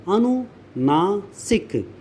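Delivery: अनुनासिक very slowly as अनु, ना, सिक; slow syllable breakdown. Shown as a demonstration. Teacher spelling out the term, अनुनासिक slow and split in parts. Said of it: "यानी".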